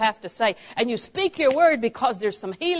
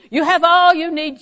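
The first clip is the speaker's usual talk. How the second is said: louder, higher proclamation; emphatic; 8 semitones higher, 10 dB louder than usual. Real, not acted. Preacher proclaiming to the congregation, louder and emphatic. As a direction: drive the point home.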